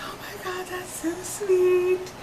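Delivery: soft, high, drawn-out cooing; mimicking someone melting at sweet news; imitated touched reaction. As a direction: coo it gently, way up high. Acting out the star's touched reply.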